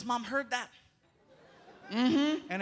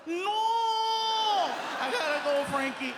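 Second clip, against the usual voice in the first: mock dramatic farewell; 10 semitones higher, 5 dB louder than usual. Dad hams up his arrest.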